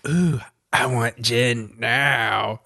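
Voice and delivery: slow, drawn-out put-on voice; imitated craving. Host voices Ronka in heat, imitated craving. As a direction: stretch every word as a mock needy demand.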